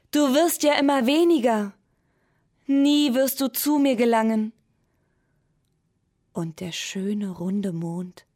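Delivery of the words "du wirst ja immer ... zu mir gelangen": higher, louder worried call. A narrator's reading. Moon calling after the shrinking pencil, higher and louder. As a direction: call out, fearing it will never reach you. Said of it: "rief der Mond".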